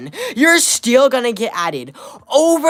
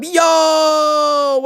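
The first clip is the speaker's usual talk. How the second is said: loud hype yell; excited shout; 8 semitones higher, 5 dB louder than usual. Real, not acted. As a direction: shout it out to the donor.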